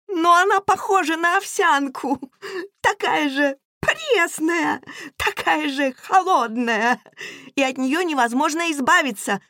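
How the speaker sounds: put-on high squeaky child's voice; squeaky little girl. Youngest girl complaining about the governess, high and squeaky. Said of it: "пропищала младшая девочка".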